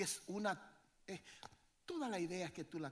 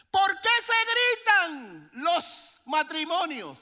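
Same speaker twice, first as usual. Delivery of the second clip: slow, high and loud rhetorical question; preaching at full force; 13 semitones higher, 16 dB louder, slower than usual. Real, not acted. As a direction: thunder it at the congregation.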